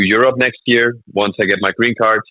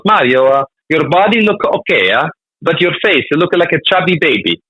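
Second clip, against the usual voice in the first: mock Italian agent, lilting and higher; Italian accent; 8 semitones higher than usual. Italian agent tells Mario his face looks chubby, mock Italian accent. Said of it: "this Italian guy, he goes".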